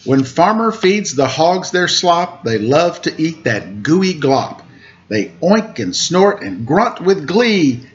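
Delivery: lilting storybook rhymes, slow refrain; reading to children. A narrator's reading. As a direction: read the rhyme aloud to little listeners. Reads picture book to children.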